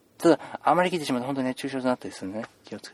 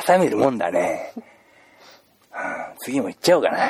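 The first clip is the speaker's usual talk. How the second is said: ordinary talk, nothing marked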